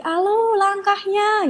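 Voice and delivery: high, drawn-out call-out imitating a coach; mock coach's yell. Trainee retelling the coach's scolding about her steps, mock yell. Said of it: "diteriakin sama pelatih".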